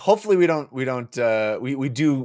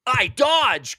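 high, louder cry; cried out; 14 semitones higher, 3 dB louder than usual. Acted. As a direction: cry out to dodge. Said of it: "cried Slick Palm".

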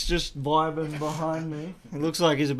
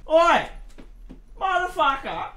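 high hammed-up yell; mock shout; 3 dB louder than usual. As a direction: yell it like an angry bogan. Mock yelling at the game character.

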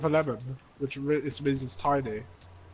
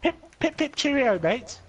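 hammed-up posh British accent, high; mock British accent; 10 semitones higher than usual. Teasing his friends in a mock British accent. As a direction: toff British greeting, pitched up. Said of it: "You do say mate".

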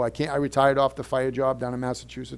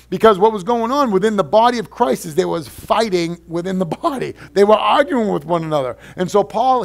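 fervent preaching, higher and louder; 7 semitones higher, 8 dB louder than usual. Acted. Pastor urging a divided church in full preaching voice.

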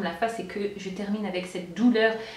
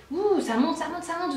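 illustrating a rising wave; 6 semitones higher than usual. Shown as a demonstration. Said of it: "c'est une montagne la contraction".

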